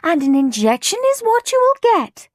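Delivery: high, loud, insistent telling-off; scolding. A narrator's reading. Nurse Needle tells children they'll get jabs, scolding. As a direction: lay down the law over the din.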